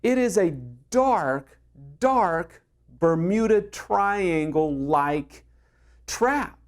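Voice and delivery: slow, ominous drawn-out build; dramatic slowdown. Acted. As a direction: stretch dark, dark like a warning. Dramatic image of resentment.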